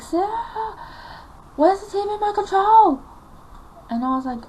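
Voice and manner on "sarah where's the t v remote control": high, loud calling-out; upset mum. Retold or imitated. Mum demanding the lost remote from daughter, upset. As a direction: holler the name, demanding an answer. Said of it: "she was so upset".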